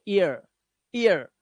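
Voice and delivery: usual speaking voice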